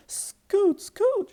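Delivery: high loud scoot; shooing. Acted. The rabbit chases the pigs away, high and loud. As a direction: chase them off. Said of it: "she said to Thomas".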